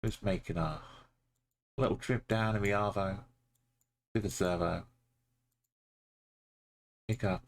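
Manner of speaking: lightly put-on Australian accent, casual; a hint of Aussie bloke heading to the servo; mock Australian accent. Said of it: "pardon me accents".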